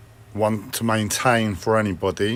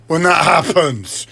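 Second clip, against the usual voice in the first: higher, slowed, insistent repeat; frustrated; 5 semitones higher, 4 dB louder, slower than usual. Real, not acted. Upset that something must be wrong, frustrated. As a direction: press it harder in frustration.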